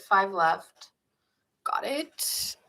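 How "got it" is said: breathy whispered aside to herself. Real, not acted. Tarot reader whispering a note to herself. Quick aside while counting signs.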